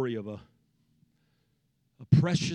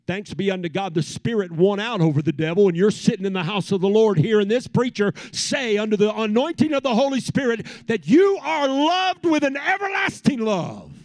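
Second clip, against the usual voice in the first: climbing louder and higher to a shouted love; full-force preaching; 7 semitones higher, 8 dB louder than usual. Acted. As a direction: preach it out with rising fire.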